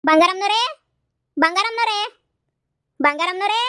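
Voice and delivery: loud, high call across the yard; loud rising call for the lady of the house. Acted. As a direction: sing out her name to fetch her. Calling out at the door.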